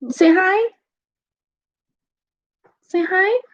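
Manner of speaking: high, coaxing lilt. Real, not acted. Coaxing a toddler to say hi, high baby talk.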